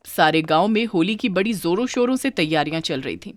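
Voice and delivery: ordinary delivery, nothing marked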